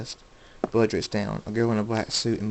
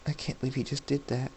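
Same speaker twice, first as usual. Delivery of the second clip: hushed shock; 3 semitones higher, 10 dB quieter than usual. A narrator's reading. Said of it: "her hand over her mouth".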